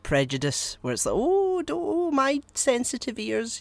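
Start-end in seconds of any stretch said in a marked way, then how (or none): 1.2-3.6 high, slow, fussy mock offense; mock prissy voice. Mocking a prudish viewer, high prissy whine. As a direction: clutch pearls, sneering at prudes.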